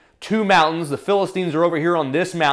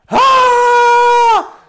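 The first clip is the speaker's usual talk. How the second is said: long, high, loud battle cry; sustained shouted war yell; 8 dB louder than usual. Acted. Israelites yelling before battle, high war cry. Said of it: "the Israelites are yelling".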